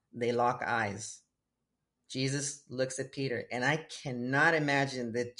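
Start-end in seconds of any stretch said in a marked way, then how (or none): none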